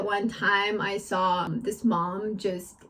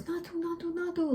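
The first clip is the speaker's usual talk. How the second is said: put-on kid voice; 5 semitones higher, 9 dB quieter than usual. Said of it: "his brother just goes".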